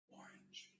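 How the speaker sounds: unvoiced whisper into the ear; whisper in left ear. Shown as a demonstration. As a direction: barely breathe the word Orange. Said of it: "I'm gonna whisper".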